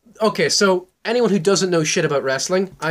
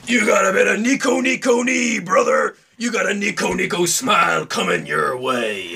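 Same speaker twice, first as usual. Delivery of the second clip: TikTok voice. Retold or imitated. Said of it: "those dumb TikToks where it's just like".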